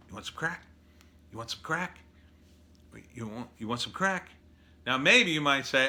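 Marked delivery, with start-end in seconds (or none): 0.1-4.3 sketchy street dealer. Retold or imitated. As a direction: hiss it out like a corner dealer offering crack. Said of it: "a sketchy guy in a hoodie standing on the corner going".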